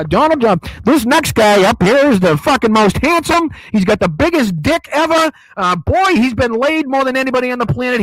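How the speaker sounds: loud, bragging ring-announcer voice; mock Trump boast. Ring announcer hyping Trump, loud boastful mock voice. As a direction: bellow it like a boxing announcer hyping himself. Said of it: "that sounded a lot like Trump introducing himself".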